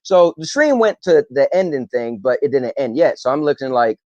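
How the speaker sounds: ordinary talk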